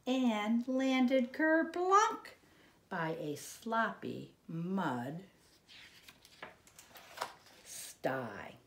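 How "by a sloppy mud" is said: plain reading voice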